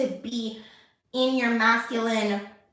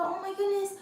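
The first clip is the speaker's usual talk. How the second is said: put-on soothing a hurt child; 10 semitones higher than usual. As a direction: coo over a scraped knee. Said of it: "I have to be able to go".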